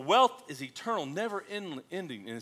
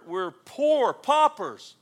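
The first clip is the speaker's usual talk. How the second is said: slow, loud, higher stretch; dragged out; 8 semitones higher, 9 dB louder, much slower than usual. Real, not acted. Preacher stretching out poor paupers, slow and loud. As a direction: drag every word out. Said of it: "stretched emphasis".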